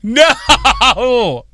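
sharp shouted no; loud protest. Real, not acted. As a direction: yell out a no.

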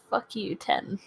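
ordinary talk, nothing marked

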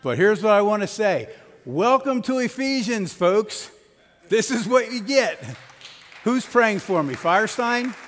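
rally the congregation, full voice; calling out to the congregation. Real, not acted. Pastor calls out to congregation.

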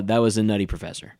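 ordinary talk, nothing marked

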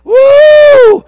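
ecstatic falsetto whoop; high whoop. Real, not acted. Preacher whooping at the healing, high and loud. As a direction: let out a high joyful woo.

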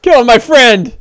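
high-pitched orc outcry; high, heated villain voice; vengeful orc. Acted. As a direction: cry out as a fighting orc. Orc attacking, in character.

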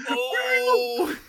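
loud high "Oh!" from an excited crowd; crowd cry. Pretend. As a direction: shout it like a stunned crowd.

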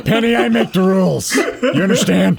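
a hint of a gruff Batman growl; lightly voiced tough boss laying down rules. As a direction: [name] lightly gruff, threatening Penny. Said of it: "Are you Batman tonight?".

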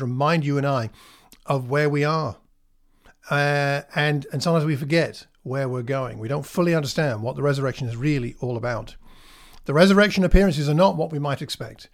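ordinary talk, nothing marked

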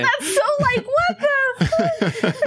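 high, slow, disbelieving squeal; laughing what the fuck; incredulous. Real, not acted. Reacting to the absurd line.

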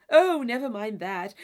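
loud impatient dismissal; dismissive exclamation. Acted. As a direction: wave the worry away impatiently. Isabella brushing off Catherine.

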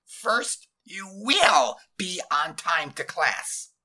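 loud, high, strangled command; slow, strangled demand; demanding scratchy voice. A narrator's reading. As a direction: stretch out each order, scratchy and forceful. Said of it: "demanding, scratchy voice".

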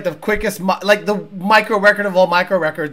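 ordinary delivery

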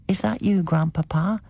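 put-on high, small child's question. A narrator's reading. The child calling to his grandfather at night, high and small. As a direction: ask like a hopeful little boy.

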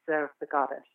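lightly mimicked reverent intonation; imitating reverence. Guest echoing the host's way of saying it, reverently. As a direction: echo her reverent way of saying it. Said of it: "You say it with such reverence".

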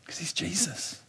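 soft whispered reverence. Real, not acted. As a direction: breathe it out softly. Whispered aside.

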